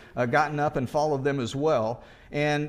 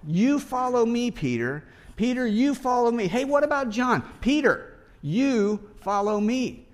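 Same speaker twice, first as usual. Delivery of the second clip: higher and louder, "you" hit hard on each "you follow me"; insistent emphasis; 6 semitones higher, 3 dB louder than usual. Acted. Pastor voicing Jesus pressing Peter to follow, insistent emphasis. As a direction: press the command home, urgent and insistent. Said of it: "what we see as the emphasis of Jesus".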